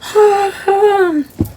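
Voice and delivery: high, drawn-out mock yawn; put-on morning yawn, high and long; waking-up yawn. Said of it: "Imagine waking up".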